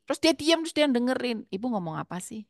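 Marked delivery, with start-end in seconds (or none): 1.5-2.4 much softer, low and gentle; calm him by barely raising your voice; speaking very softly. Retold or imitated. Mother speaking softly to angry son, very quiet. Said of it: "pelan sekali".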